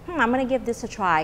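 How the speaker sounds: ordinary talk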